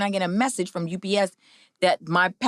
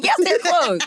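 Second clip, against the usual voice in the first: insistent; 11 semitones higher, 4 dB louder, slower than usual. Retold or imitated. Retold insistent reply.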